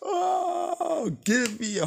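high delighted yelp; excited cry. Real, not acted. Reviewer thrilled by a horror Santa, squealing. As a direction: squeal with glee at the idea. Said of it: "reacting to the scene".

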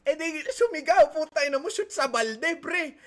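high, loud triumphant outburst; excited crowing. Retold or imitated. Gamer celebrating that the opponent shouted in the debate, loud excited crowing. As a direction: crow loudly like a gamer landing a winning shot.

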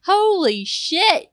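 high, loud, slow exclamation; amazed. Pretend. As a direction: stretch out each word in astonishment. Reacts to the reveal.

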